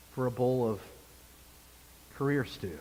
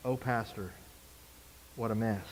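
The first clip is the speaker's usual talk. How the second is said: softer, lower, a mild hopeless groan; despairing sigh; 9 semitones lower, 11 dB quieter than usual. Retold or imitated. Church member in the pastor's office, mildly despairing and softer. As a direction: sigh it out like someone defeated. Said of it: "head in their hands going".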